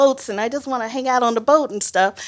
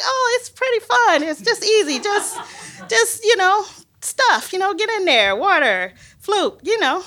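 much higher, carefree chirpy voice; mock breezy colleague; 10 semitones higher than usual. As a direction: wave the danger away lightly. Imitating cheerful colleagues.

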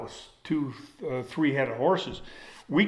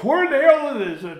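much higher and louder fed-up complaint; exasperated brother; 12 semitones higher, 7 dB louder than usual. Retold or imitated. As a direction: squeal it out, sick of the climb. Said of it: "he goes".

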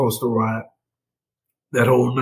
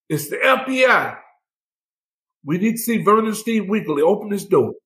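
high-pitched imitated agent's demand; FBI at door; 7 semitones higher than usual. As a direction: call out like an agent demanding entry. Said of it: "big bang on the door".

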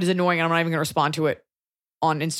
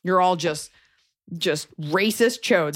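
ordinary talk

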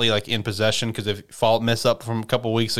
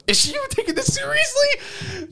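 very high, squeaky mock disbelief; hammed-up incredulous squeal. Character wondering to himself whether she takes it seriously, incredulous.

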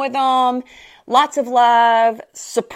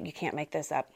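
ordinary talk, nothing marked

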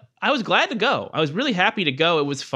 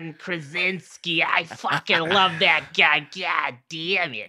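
put-on slow, heavy Boston accent; doing a Boston guy; slower than usual. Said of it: "He's a Boston guy".

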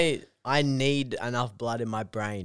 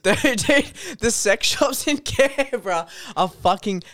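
excited; 8 semitones higher, slower than usual. Real, not acted. Excited laughing outburst.

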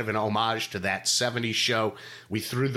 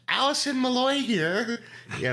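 high put-on female voice; Allison voice; 12 semitones higher than usual. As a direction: pitch way up as the fictional Allison. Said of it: "do you wanna do the Allison voice today".

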